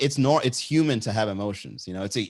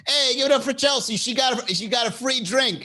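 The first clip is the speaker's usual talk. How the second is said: higher, louder club MC; mock hype announcer; 13 semitones higher, 3 dB louder than usual. A streamer playing a club MC cheering for Chelsea. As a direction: work the crowd for Chelsea, half joking.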